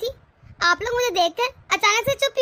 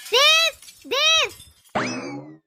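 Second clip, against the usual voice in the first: high, drawn-out summons; angry calling out; 4 semitones higher, much slower than usual. Acted. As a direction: call out for your brother in a huff. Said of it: "आप इतने गुस्से में क्यों हैं". (गुस्से में खींचकर पुकारना)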